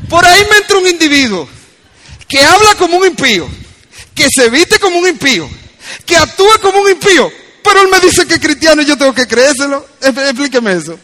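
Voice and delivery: high, loud, challenging preacher; forceful preaching. Real, not acted. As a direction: hammer out each charge to the congregation.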